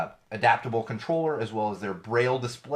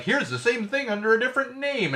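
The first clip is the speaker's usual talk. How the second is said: mock pitchman; 11 semitones higher than usual. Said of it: "they're like".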